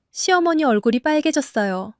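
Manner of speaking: ordinary delivery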